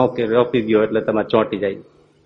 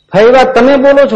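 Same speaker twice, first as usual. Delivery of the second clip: much higher, louder question; astonished; 12 semitones higher, 8 dB louder than usual. Acted. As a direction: gasp it out in disbelief.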